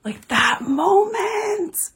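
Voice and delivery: high, slow, voice cracking with tears; barely getting the words out through emotion; choked up. Real, not acted. Overwhelmed by favorite scene.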